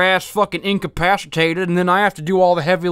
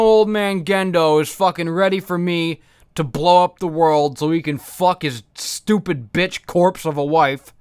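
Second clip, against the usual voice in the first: normal talking voice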